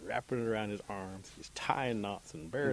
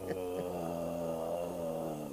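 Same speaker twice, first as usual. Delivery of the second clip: long, low groan; spent groan; 5 semitones lower, 3 dB quieter than usual. Acted. Rabbit cut down and lying there, long low groan. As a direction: groan it out, drawn out and drained.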